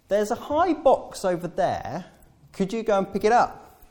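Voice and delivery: high, coaxing request; asking a favour. Retold or imitated. Churchgoer asking tall Peter, high coaxing request. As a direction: ask sweetly for the favour. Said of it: "I know what comes next".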